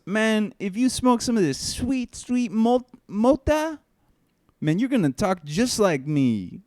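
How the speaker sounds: high, loud slick dealer voice; voiced dealer Fulgencio. Dealer Fulgencio tempting the kid, high slick voice. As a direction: sell it high and smooth. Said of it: "He goes".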